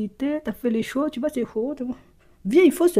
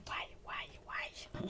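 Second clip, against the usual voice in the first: whisper; 25 dB quieter, much slower than usual. Real, not acted. Whispered interjection.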